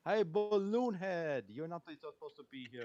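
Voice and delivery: put-on loud, slow German accent. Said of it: "you slipped into a German accent there".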